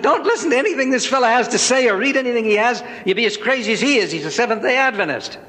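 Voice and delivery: lightly squeaky little man warning. Retold or imitated. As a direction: fire watch warns the preacher about the giant, squeaky. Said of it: "he squeaked out and said".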